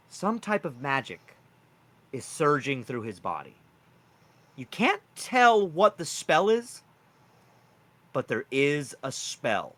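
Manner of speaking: usual speaking voice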